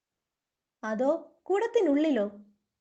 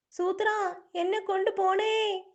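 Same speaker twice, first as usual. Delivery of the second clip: alarmed calling out; 6 semitones higher, slower than usual. Acted. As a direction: call out desperately, climbing to a shout. Calling out as companion is carried off. (ഉയർന്ന സ്വരത്തിൽ പരിഭ്രമിച്ച വിളി)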